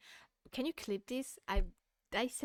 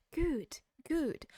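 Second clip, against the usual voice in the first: high and louder, mock approving; sarcastic praise; 7 semitones higher, 5 dB louder than usual. Real, not acted. Streamer mockingly praising the apology video. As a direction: cheer it sarcastically.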